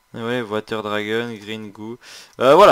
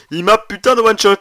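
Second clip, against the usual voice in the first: indignant shout; 10 semitones higher, 14 dB louder than usual. Real, not acted. Complains to viewers loudly.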